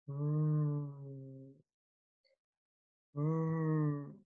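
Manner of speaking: R sound demo. Shown as a demonstration. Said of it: "look at your mouth in the mirror like this and say".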